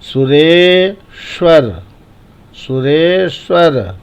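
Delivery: सुरेश्वर with रे stretched, ending on a short र; long vowel held. Shown as a demonstration. Teacher models the long vowel for pupils, रे drawn out. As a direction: hold the रे in सुरेश्वर, then श्वर. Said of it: "ये भी बड़ी पाई में गिनी जाती है".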